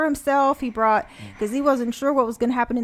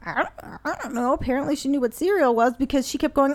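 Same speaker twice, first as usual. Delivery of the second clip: usual speaking voice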